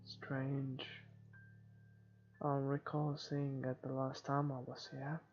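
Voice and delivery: ordinary delivery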